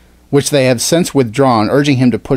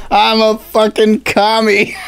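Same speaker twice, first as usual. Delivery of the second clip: mock commie; 10 semitones higher, 3 dB louder, slower than usual. Joking in mock Russian voice.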